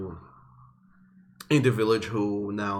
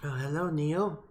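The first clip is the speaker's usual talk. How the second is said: put-on much higher, meek young Triton guide; young Triton woman; 5 semitones higher, 4 dB quieter than usual. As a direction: greet the travellers sweetly, in a high, shy voice. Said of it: "turns back to you and says".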